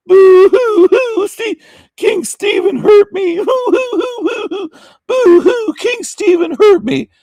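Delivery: very high, loud, slow fake blubbering; hammed-up sobbing falsetto; mock crybaby wail. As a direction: wail like a whiny cartoon crybaby. Mocking a crying opponent.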